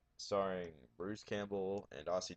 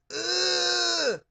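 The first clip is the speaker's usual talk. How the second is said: very high, loud strained mock scream; weird scared scream; 12 dB louder than usual. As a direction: imitate a ridiculous frightened movie scream. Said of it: "he has the weirdest fucking scream".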